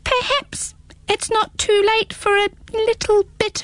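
squeaky small creature, teasing; little hedgehog voice. Acted. Hedgehog hinting.

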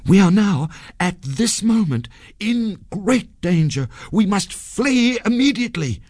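high-pitched urgent warning; theatrical panic, urging escape; urgent alarm. A narrator's reading. Sanjay feigns great danger.

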